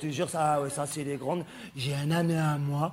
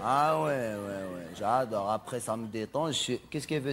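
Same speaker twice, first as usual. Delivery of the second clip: ordinary talk, nothing marked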